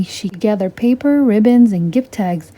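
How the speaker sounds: usual speaking voice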